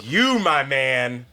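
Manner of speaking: very slow, weighty pronouncement; emphatic praise. Retold or imitated. Imitating lookalike crediting the party hero, slow and emphatic. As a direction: stretch each word in admiration.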